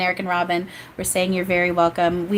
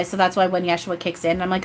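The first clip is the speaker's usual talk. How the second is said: normal talking voice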